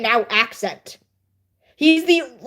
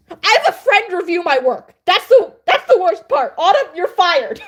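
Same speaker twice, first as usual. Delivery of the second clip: higher, louder playful exasperation; hammed-up indignation at a friend; mock outrage; 6 semitones higher, 3 dB louder than usual. Writer jokingly firing the friend who reviews, mock outrage.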